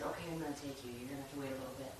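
a hint of a calm inner guide; wise voice. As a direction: gently soothe like a patient parent. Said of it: "the wise voice said".

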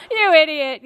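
loud, high retold yell, half laughing. Local man yelling at her, loud and half laughing. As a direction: shout the insult, laughing through it. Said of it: "yelling at me".

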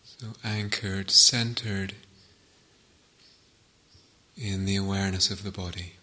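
calm meditation guide with long pauses between phrases; guided meditation. Real, not acted. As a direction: settle listeners into stillness, phrase by unhurried phrase.

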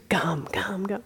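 a hint of a whining kid, whispered; whispered begging. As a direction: whisper it like a child pleading. Imitating her daughter asking for gum.

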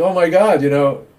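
a touch of mock alarm; mock despairing old man. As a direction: ham up the worried thoughts. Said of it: "they should be thinking".

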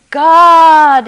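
full-voiced cry for God; desperate shout. A narrator's reading. Hanging man yells up to God, desperate shout. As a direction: bellow it across the canyon. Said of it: "he shouted again".

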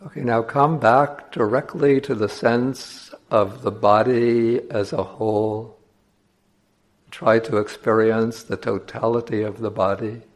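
slow, measured meditation voice with long pauses; guided meditation. Acted. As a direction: guide listeners slowly, leaving space between phrases.

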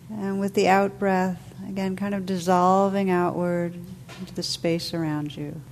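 calm, unhurried meditation voice with long pauses; guided meditation. Real, not acted. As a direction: guide listeners gently into stillness, letting phrases settle.

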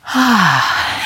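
long audible sigh out; settling sigh. Real, not acted. Teacher settling the group into posture, releasing sigh. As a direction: let out a big, releasing sigh.

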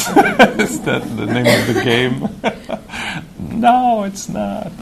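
high, laughing through the words. Real, not acted. Teacher joking with meditation group about the bell, laughing. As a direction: break into laughter on the joke question.

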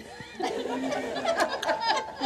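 a quick airy laugh at herself; breathy laugh. Real, not acted. Teacher laughing at her own story mid-class, breathy laugh. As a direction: let a soft unvoiced laugh slip out.

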